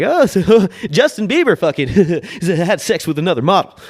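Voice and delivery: high, loud, laughing talk-show host; Jimmy Fallon impression. Podcaster mimics a TMZ staffer as Fallon, loud and giggling. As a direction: giggle through the gossip like Fallon. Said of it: "Why did I just do Fallon?".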